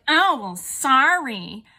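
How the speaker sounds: loud, drawn-out imitation of an insincere sorry; sarcastic mock apology. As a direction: stretch it out mockingly, dripping with sarcasm. Mimicking the girls' insincere apology.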